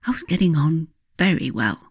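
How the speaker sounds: ordinary delivery, nothing marked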